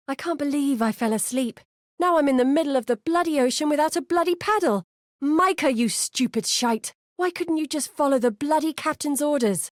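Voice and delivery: cursing himself adrift on the ocean; exasperated swearing; furious stranded sailor. Acted. Stranded sailor cursing his mistake.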